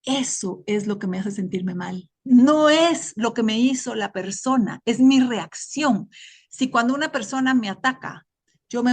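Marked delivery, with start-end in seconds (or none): 2.2-6.1 slow and louder, leaning on mi; emphatic point. Real, not acted. Speaker stressing to her group that it's her reaction, slow and emphatic. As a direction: hit the word mi, slowly and firmly.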